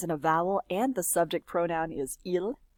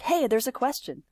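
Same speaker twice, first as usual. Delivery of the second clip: attention-getting call; 5 semitones higher than usual. Shown as a demonstration. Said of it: "It's kinda like".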